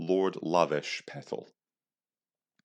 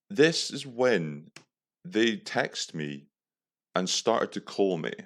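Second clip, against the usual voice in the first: slow dramatic; slower than usual. Acted. Dramatic reveal of scam calls.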